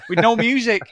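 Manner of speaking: ordinary talk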